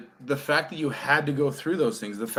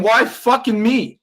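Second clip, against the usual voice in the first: self-pitying whine; 7 semitones higher, 6 dB louder, slower than usual. Retold or imitated. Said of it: "stop saying".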